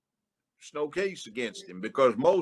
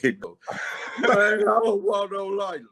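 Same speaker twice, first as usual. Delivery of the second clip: high, loud, whining mock plea; whiny defendant; 9 semitones higher, 7 dB louder than usual. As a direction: imitate a crybaby thug begging.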